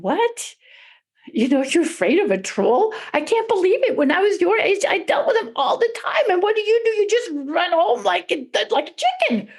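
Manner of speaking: high, scoffing scolding; incredulous father. A narrator's reading. Father scolds son who fled the troll, scornful and incredulous. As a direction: build to a high-pitched jeer on the last words.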